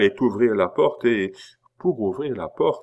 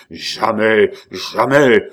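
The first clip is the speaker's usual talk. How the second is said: loud, forceful shout; furious refusal; 9 dB louder, slower than usual. A narrator's reading. Abbé brandishes his whip at Pitou, furious refusal. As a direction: shout it twice, harder the second time.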